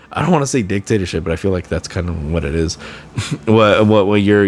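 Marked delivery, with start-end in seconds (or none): none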